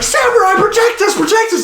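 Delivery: very high frantic plea; mock panicked plea. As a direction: squeal for rescue like terrified villagers. Villagers' panicked cry imitated.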